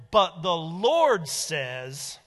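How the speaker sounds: "Lord" very slow and loud; preacher's emphatic "Lord"; stress on Lord. Shown as a demonstration. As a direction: hammer "Lord" slowly and loudly. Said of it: "this is an emphasis".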